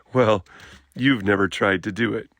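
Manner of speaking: ordinary delivery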